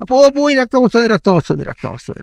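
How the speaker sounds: high whine falling away; hammed-up wail that fades; mock crying. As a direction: imitate a child crying over a cut. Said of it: "అని ఏడుస్తుంది".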